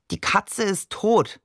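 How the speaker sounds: slow, absent-minded statement; lost in thought. A narrator's reading. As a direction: say it slowly, as if thinking aloud. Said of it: "sprach er gedankenversunken auf Arabisch".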